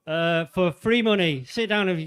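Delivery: loud, slow bingo hall call; bingo caller. Acted. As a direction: boom it out like calling numbers. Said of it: "that was a bit like bingo caller".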